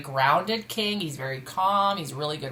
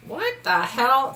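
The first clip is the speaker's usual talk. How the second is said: stunned disbelief; 6 semitones higher, 6 dB louder, much slower than usual. Real, not acted. Reader stunned by the card spread.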